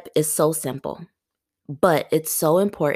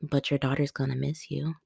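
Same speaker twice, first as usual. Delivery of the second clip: whispering guilt; 8 dB quieter than usual. Pretend. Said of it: "guilt was whispering in my ear and saying".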